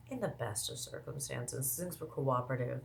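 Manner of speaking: said softly, almost under the voice; quiet aside. Real, not acted. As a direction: drop the volume for a pointed aside. Repeats phrase as a quiet aside.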